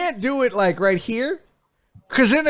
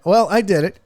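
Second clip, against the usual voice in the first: ordinary talk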